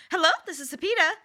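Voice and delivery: quick, high-pitched phone greeting; customer service voice. Retold or imitated. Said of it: "straight up customer service voice".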